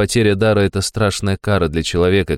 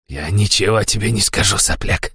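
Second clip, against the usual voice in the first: snarled, ending in a hiss; bare teeth and snarl it; 2 semitones higher than usual. A narrator's reading. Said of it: "окрысился тот".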